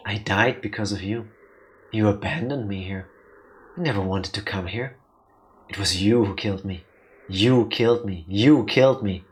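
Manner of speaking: low, haunting accusation, building in force; accusing shadow. Acted.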